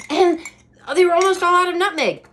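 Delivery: high, loud, fast outrage; indignant. Real, not acted. Telling viewers the store ran out, high indignant. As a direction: blurt the shock of empty shelves.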